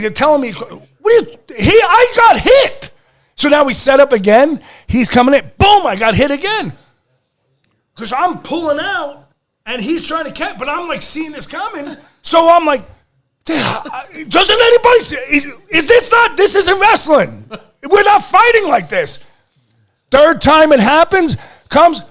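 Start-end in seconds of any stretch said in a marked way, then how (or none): 0.5-2.9 high, loud, slow outraged cry; indignant protest. Retold or imitated. Wrestler protesting to the referees, outraged. 13.5-19.2 outraged yell. Retold or imitated. Wrestler yelling at the officials, outraged. Yelling protest during match.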